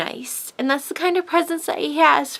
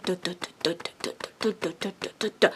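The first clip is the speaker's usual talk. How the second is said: soft, much quieter heartbeat thump sounds; imitated heartbeat; 6 semitones lower, 12 dB quieter, much faster than usual. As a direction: tap out a racing heartbeat under your breath. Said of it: "My heart started going".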